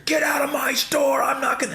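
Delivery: high, strained mock yell of a store owner; imitated yelling. Store owner yelling at a customer in a MAGA hat, mock furious shout. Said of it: "He's just yelling and screaming".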